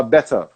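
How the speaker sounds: better with a clear T, no R-like flap; British T. Shown as a demonstration. Teacher showing the British way, "better" with T. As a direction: say "better" with a crisp T. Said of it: "eu falo com T".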